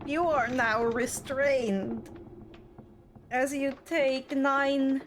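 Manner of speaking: ordinary delivery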